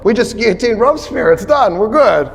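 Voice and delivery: reassuring leaders. Retold or imitated. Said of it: "the French revolutionary leadership goes".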